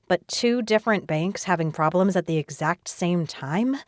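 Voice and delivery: usual speaking voice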